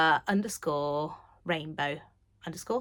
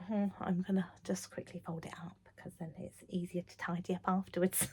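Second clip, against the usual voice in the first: whispered aside; 10 dB quieter than usual. Real, not acted. Aside while folding.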